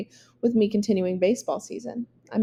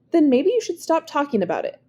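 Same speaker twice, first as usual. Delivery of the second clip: high, sharp and louder, cutting him off; curt retort; 6 semitones higher, 4 dB louder than usual. A narrator's reading. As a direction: shut him down bluntly. Jayla snaps at rambling Alex.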